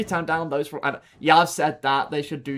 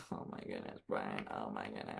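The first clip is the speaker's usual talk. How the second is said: much softer, unvoiced oh my goodness; whispered disbelief; 18 dB quieter than usual. Real, not acted. Whispering to Brian in disbelief, soft and unvoiced. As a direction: breathe it out in disbelief.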